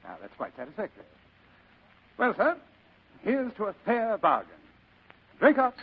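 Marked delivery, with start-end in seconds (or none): 2.2-5.8 high, loud jovial host raising a glass; hearty toast. Acted. Fat man urging his guest to drink, high jovial toast. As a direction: push the drink on him with sly cheer.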